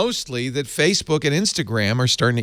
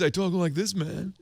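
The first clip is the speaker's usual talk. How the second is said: lightly voiced laid-back surfer dude, higher and softer; Valley boy voice; 7 semitones higher, 8 dB quieter than usual. Shown as a demonstration. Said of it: "when I do Valley Boys".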